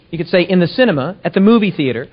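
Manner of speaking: ordinary talk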